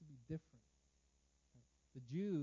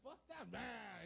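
slow, growled monster roar, hammed-up; Hulk breaking out; 6 semitones higher, much slower than usual. Preacher acting the Hulk smashing a cage, slow mock roar. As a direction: bust out like the Hulk, half-played. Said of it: "You're gonna be like".

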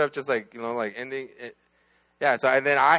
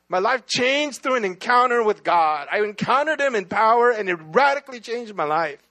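raised, high testifying voice; fervent preaching; 5 semitones higher than usual. Real, not acted. Preacher testifying to a life-changing encounter with God, fervent and high. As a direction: declare it with conviction, punching key words.

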